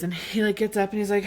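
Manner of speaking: usual speaking voice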